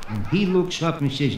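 normal talking voice

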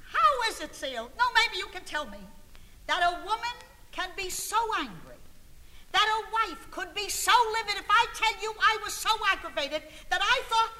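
high, loud comic outrage; livid exasperation. Acted. Angry wife asking [name] to explain it, livid. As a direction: work yourself up as you appeal to a friend. Recounts how livid she was.

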